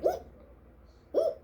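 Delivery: breathy, high, scandalised "ooh"; whispered ooh. Retold or imitated. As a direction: gasp at the drama. Reacting to loan being called.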